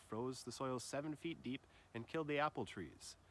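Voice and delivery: ordinary talk, nothing marked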